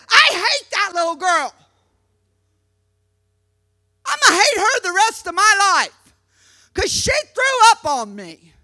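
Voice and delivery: high, hammed-up declaration of a grudge; mock childish hatred. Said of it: "Wouldn't that have been real dumb".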